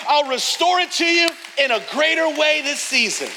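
high, loud, stretched-out preacher cry; booming sermon climax; full-force preaching. Acted. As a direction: preach it to the back row.

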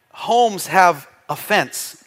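slowed, leaning on "a fence" to sound like "offense"; slow pun delivery. Shown as a demonstration. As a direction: draw out "homes have a fence" so it sounds like offense. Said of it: "Get it?".

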